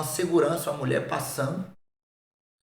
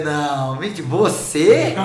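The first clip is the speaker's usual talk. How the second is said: laughing disbelief; 6 dB louder, much slower than usual. Retold or imitated. Disbelief at friend's conversion.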